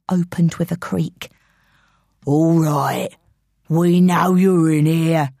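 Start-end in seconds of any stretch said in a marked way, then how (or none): 2.2-5.3 slow, lowered menacing man; man's voice. Acted. Intruder calling to someone hiding, slow man's voice. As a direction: drag it out as a man hunting someone. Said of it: "said a man's voice".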